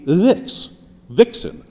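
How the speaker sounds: vix pulled out alone, then vixen with VIX stressed; slow vix, then a falling vixen. Shown as a demonstration. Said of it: "stress on the first of the two syllables".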